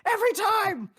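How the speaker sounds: high, loud mock outrage; imitating angry friend. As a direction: squeal the grievance way up high. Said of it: "He was just pissed off".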